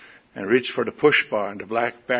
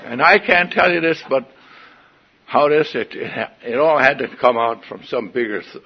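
ordinary talk

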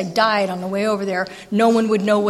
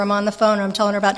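ordinary delivery

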